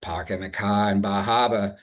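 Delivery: imitated Boston accent with dropped Rs; broad mock Boston vowels, no Rs. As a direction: drop every R like a Bostonian. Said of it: "from Boston who are talking about".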